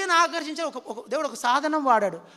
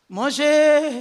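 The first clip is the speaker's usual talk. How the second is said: loud divine call; 3 semitones higher, 10 dB louder, much slower than usual. Acted. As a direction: call his name out loud and echoing. God calls Moses from the bush. ("మోషే" అని బిగ్గరగా, దూరం నుంచి పిలుస్తూ)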